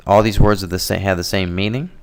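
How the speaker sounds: usual speaking voice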